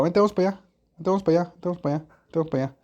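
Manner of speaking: "vente, vamos para allá" dicho cada vez más suelto y bajo, como "vente, vamos pa' allá"; habla descuidada que se apaga. Shown as a demonstration. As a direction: repite la frase comiéndose sílabas y apagando la voz. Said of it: "ustedes me entienden que estoy diciendo". ("vamos para allá" con "para" reducido a "pa", cada vez más quedo)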